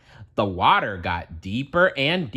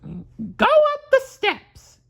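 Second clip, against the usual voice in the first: high cartoon mouse voice, slow and deliberate; Mickey ordering slowly; 3 dB louder, slower than usual. Mickey slowly telling the broom to climb, high mouse voice. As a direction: spell out each word up high like a cartoon mouse. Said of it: "said Mickey".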